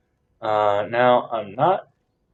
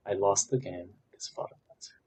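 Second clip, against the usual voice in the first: whisper; 2 semitones lower, 8 dB quieter, much faster than usual. Real, not acted. Repeats complaint under breath.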